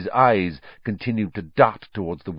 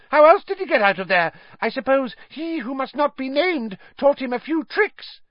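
shrill, very high, loud cry; Pettigrew shrill shout; 4 dB louder than usual. A narrator's reading. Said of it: "Pettigrew shouted shrilly".

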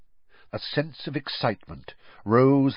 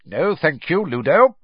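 higher, louder refusal with a bite; impatient; 7 semitones higher, 7 dB louder than usual. A narrator's reading. Crouch refusing Bagman's offer of a seat, impatiently. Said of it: "there was a bite of impatience in his voice".

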